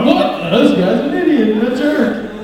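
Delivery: high, loud mock scorn; scornful driver. Imagined driver behind, high loud mock scorn. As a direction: sneer like the disgusted driver. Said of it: "he might be like".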